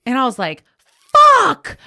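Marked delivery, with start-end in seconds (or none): 1.1-1.8 loud high shocked swear; loud angry outburst; pissed-off curse. Retold or imitated. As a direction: spit out the swear like bad news just landed. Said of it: "I mean, I was so pissed".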